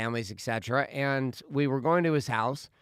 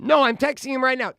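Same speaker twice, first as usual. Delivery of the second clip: high, loud mock protest; freaking-out daughter; 11 semitones higher, 7 dB louder than usual. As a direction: imitate a panicked preteen digging in. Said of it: "Freaking out about the idea".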